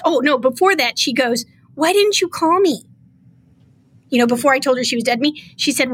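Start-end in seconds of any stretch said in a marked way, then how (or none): 1.8-2.8 higher-pitched pleading question; retold plaintive question; imitated concern. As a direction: mimic her wounded appeal.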